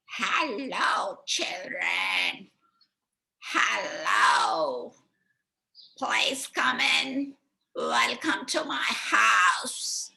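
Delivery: put-on high, slow, creaky old witch luring children in; sweet-talking witch at her door; high, slow witch voice coaxing the children inside.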